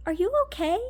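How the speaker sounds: high, careful check-in voice; high-pitched, tentative concern; concerned manager. Retold or imitated. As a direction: lightly imitate a worried boss asking gently. Manager's concerned question, acted out.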